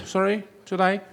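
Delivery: usual speaking voice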